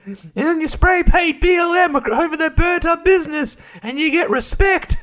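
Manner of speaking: very high squeaky cartoon plea, breaking into laughs; Toadstool. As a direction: plead in a tiny high voice. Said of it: "Toadstool comes up and he's".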